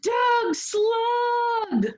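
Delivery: high, ringing call of the spell; magic rhyme call. Acted.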